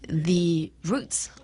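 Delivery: normal talking voice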